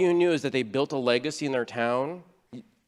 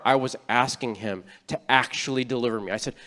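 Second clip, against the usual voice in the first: normal talking voice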